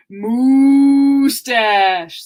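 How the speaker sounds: loud, lowing cow. Acted. Reading a kids' book aloud, mooing cow voice. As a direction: moo it out like a cow. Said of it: "says the cow".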